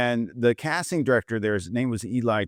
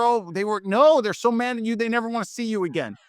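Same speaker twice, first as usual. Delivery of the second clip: much higher, urgent scolding voice; exasperated manager; 10 semitones higher than usual. Retold or imitated. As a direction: act the manager in disbelief.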